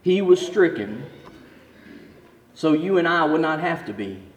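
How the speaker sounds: slow, hushed, weighty; solemn preaching. Acted. Preacher drawing the lesson, solemn and slow.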